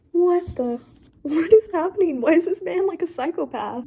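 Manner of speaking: high, loud, stunned "what the"; shocked disbelief. Real, not acted. Reader reacting to a shocking book scene, high and loud in disbelief. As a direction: reel back in real shock at the page.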